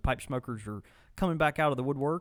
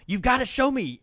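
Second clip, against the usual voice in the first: eager excited quote; 11 semitones higher, 8 dB louder than usual. Retold or imitated. Eager to see explosion videos.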